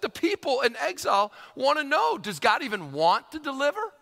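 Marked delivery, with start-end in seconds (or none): none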